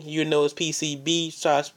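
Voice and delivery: usual speaking voice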